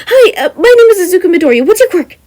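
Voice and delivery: high, loud, rushed and laughing; nervous excited fanboy. Acted. Eager student finally getting to ask, rushed and excited. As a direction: blurt it out too fast with nervous laughter.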